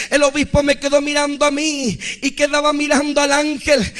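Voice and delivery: ordinary talk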